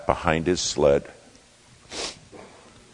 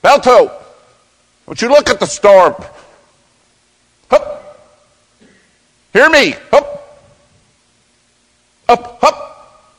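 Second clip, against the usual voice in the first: loud, high command to the lead dog; musher's shout; 13 dB louder than usual. Retold or imitated. Musher yelling to his lead dog Balto, loud command. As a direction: call the dogs to pull, full voice. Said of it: "Gunnar Kaysen said".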